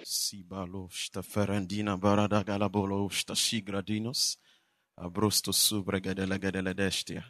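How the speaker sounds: low, hushed, fervent prayer in tongues. Real, not acted. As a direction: murmur the prayer softly, far from the crowd.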